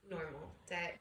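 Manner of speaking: ordinary delivery, nothing marked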